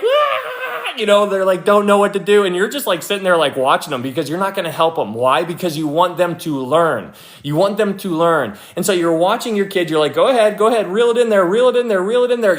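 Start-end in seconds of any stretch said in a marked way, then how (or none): 0.0-0.9 very high squealing laugh; mock panicked yelp with a laugh; kid's panicked squeal. Kid freaking out with fish. 10.1-12.6 higher, excited urging; mock eager parent egging on; eager coaching. Parent coaching kid to fish.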